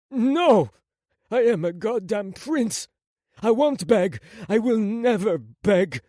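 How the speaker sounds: high, loud, proud refusal; defiant outburst. Acted. Wounded prince refusing to beg, defiant shout. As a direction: declare it fiercely through the pain.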